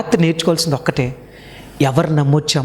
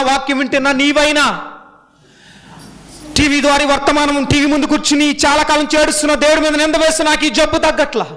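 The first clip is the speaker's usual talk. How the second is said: preaching at full force; 10 semitones higher than usual. Acted. Pastor calls out to the congregation and TV viewers, preaching at full force. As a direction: plead their complaints aloud to the crowd.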